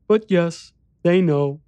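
put-on high child voice; grave little boy, pitched well up; solemn young boy. A narrator's reading. Boy admitting the horror, solemn child voice.

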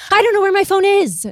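high, loud panicked blurt; panicked quote. Retold or imitated. Mimicking Carrie losing her phone, high loud panic. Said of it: "she is so panicked".

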